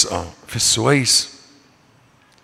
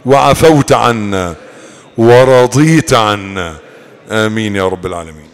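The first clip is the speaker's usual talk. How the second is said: prayer; 6 dB louder than usual. Real, not acted. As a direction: draw each plea out in prayer. Closing supplication of a sermon.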